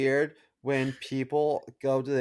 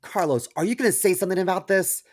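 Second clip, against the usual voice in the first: put-on higher, quick nagging girlfriend voice; lightly voiced girlfriend prodding him to act; imitating Julie; 7 semitones higher, 5 dB louder, faster than usual. Teasing co-host imitates Julie prodding, quick and higher. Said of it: "Julie said".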